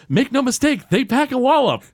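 high stern imitation; mock solemn coach; Mr. Burns. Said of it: "And he goes".

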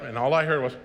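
normal talking voice

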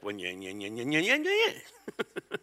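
exaggerated whiny taunt, building as it goes; mock nagging tone. Spouse's remark as you think you hear it, mock nagging. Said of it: "you think you hear a tone".

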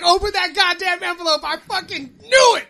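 high, loud, gloating yell; hammed-up victorious outburst; triumphant shout. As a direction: crow over a bet won. Acted triumph at guessing right.

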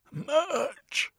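very high yelp; sudden cry. Acted. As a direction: blurt it out.

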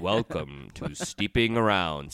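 slow, booming radio announcer read; deep radio voice. Shown as a demonstration. Host showing off his cold-roughened radio voice. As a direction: lean into the big broadcast voice. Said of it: "I got that deep radio voice".

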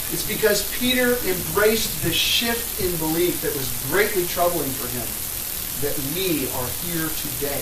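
loud, rising preaching voice; preacher voice. Real, not acted. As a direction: preach it out with full force. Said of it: "I'll use my preacher voice".

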